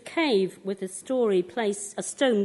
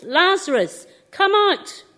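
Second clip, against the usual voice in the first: loud command; 8 semitones higher, 8 dB louder than usual. A narrator's reading. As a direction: call out loudly, higher-pitched. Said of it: "called out in a loud voice".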